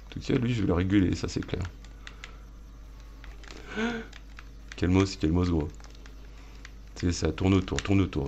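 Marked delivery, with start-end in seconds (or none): none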